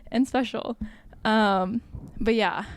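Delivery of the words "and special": giddy. Retold or imitated. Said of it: "And I was like".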